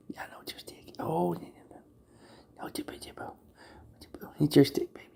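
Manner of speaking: hushed tender whisper to pet; soft whisper. Real, not acted. Owner whispering gently to his cat over the stick.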